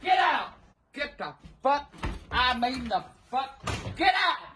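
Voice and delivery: usual speaking voice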